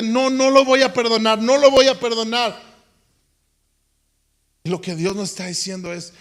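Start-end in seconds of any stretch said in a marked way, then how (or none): 0.0-2.6 high, loud, stubborn "no lo voy a perdonar"; the preacher mimicking an unforgiving believer, stubborn; stubborn refusal. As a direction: dig your heels in.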